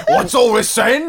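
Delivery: put-on high, loud, laughing English policeman accent; mock English bobby. Joining the accent game as a laughing English bobby. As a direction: play a comic British bobby in an English accent. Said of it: "vacillating between two different accents".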